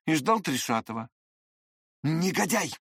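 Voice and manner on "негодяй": raised-pitch burst of insult; Lambert's angry outburst. A narrator's reading. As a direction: let the insult slip out, unable to hold back. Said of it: "не утерпел было Ламберт".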